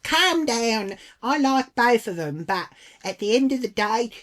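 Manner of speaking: badly faked Irish woman protesting; bad Irish accent. Irish lady telling her to calm down, bad Irish accent. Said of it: "Can't do the accent".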